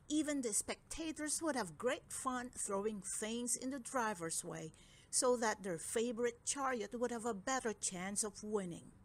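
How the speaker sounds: usual speaking voice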